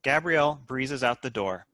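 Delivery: usual speaking voice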